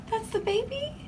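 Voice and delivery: a sharp gasp, then a high, soft question; gasping surprise. Real, not acted. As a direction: gasp and ask in disbelief. First sight of the baby.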